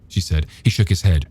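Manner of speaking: usual speaking voice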